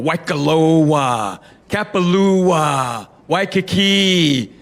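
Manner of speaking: very slow, each syllable of "Waikoloa" drawn out; slow, savoring Hawaiian place names; Hawaiian cadence. Shown as a demonstration. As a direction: stretch "Kapalua" and "Waikiki" lovingly like a local. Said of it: "You pick up their cadence".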